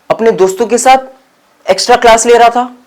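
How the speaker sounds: stammering, high and loud excuse; faltering nervous lie. Acted. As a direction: blurt out a shaky alibi. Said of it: "लड़खड़ाते हुए कह दिया". (लड़खड़ाती आवाज़ में झूठा बहाना)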